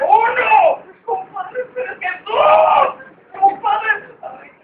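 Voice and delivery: agudo, fuerte, con llanto; llanto asustado. Acted. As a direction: suplica llorando, muy alto. Comadre llorando asustada ante cuenta. (la comadre suplica entre llanto exagerado)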